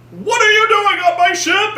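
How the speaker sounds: high, strained mock villain shout; hammed-up outraged demand; threatening villain. Zod arguing with the hologram, threatening shout. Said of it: "threatening the hologram".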